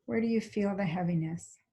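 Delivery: calm, even body-scan guidance with long pauses; guided meditation. Real, not acted. Teacher leading a class into a body scan, calm guided meditation. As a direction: guide them gently, leaving space between phrases.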